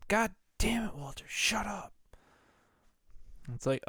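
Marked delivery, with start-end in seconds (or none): 0.1-1.9 high, strained telling-off; high mock fury, dropping in volume at the end; mock exasperated outburst. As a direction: act out losing patience, voice pitched up. Acted outburst telling Walter to shut up.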